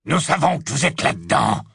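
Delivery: a hint of the low rasping threat; menacing, lightly voiced rough stranger; hoarse voice. Snatcher threatening the friends in the tent, lightly rasping menace. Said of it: "une voix rauque".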